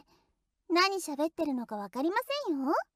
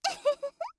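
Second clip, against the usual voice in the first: high, mischievous little giggle; teasing giggle; 12 semitones higher than usual. Acted. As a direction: giggle in delight at the prank. After teasing the thief. (いたずらっぽく高くくすっと笑う)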